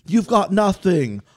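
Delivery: loud, high British tough-guy boast; British accent. Retold or imitated. Bear taunting Hunter to his face in a British accent. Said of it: "You do a good British accent".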